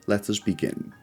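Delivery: ordinary delivery, nothing marked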